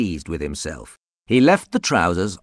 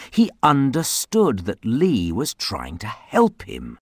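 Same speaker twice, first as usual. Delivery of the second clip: usual speaking voice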